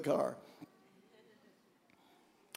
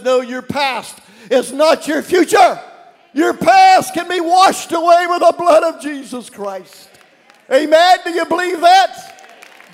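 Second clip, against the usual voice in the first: loud, high fiery preaching; preaching at full force; 9 semitones higher, 12 dB louder than usual. Real, not acted. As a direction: shout it to the congregation. Preaching climax.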